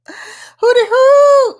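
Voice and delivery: high, loud, slow hooting call; hooting greeting. Real, not acted. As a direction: sing out a big welcome hoot.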